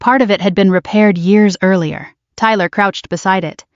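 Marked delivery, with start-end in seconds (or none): none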